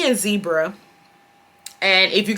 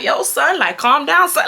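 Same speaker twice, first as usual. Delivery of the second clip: higher New York voice telling the manager to calm down; Chuck's New York talk; 6 semitones higher than usual. Retold or imitated. Friend imitates Chuck telling the manager to calm down, in a mock New York voice. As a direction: imitate a Houston guy faking New York slang. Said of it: "how Chuck give it up".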